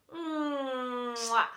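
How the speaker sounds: loud smacking air kiss; blown kiss. Acted. Teacher blowing a kiss down the corridor, loud mwah. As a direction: blow a big sloppy kiss. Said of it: "to blow a kiss to Miss Dovey".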